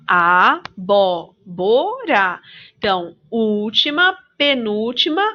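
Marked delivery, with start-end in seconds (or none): 0.1-2.4 "Abóbora" very slow, split as a-bó-bo-ra; syllable by syllable. Shown as a demonstration. Teacher dividing the word for children, slow syllables in "Abóbora". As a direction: stretch each syllable of "Abóbora" separately. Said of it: "o bó".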